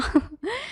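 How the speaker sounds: small soft laugh to herself; short chuckle. Real, not acted. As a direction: let out a brief quiet chuckle. Narrator chuckles at her own aside. (ضحكة خفيفة)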